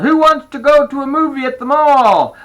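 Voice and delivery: Dad calling to the whole house, loud and high. A narrator's reading. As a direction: sing it out loudly to the kids. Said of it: "Dad called".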